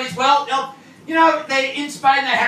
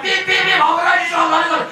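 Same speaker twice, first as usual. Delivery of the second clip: a frantic bus driver yelling as the runner darts out; panicked driver; 7 semitones higher than usual. Retold or imitated. Bus driver shouting in mock panic at the man in front. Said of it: "he's just been like".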